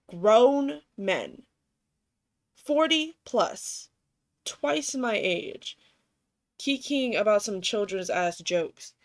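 slow, emphatic, incredulous words; exasperated rant. Retold or imitated. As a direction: spell out how ridiculous it is, word by word. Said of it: "and I was like".

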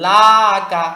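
"লাকা" with a long drawn-out first "লা"; wrongly stretched vowel. Shown as a demonstration. Teacher showing learners the mistake, "লাকা" with stretched "লা". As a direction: drag out the "লা" of "লাকা", loudly. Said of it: "টান দিয়ে ফেলা".

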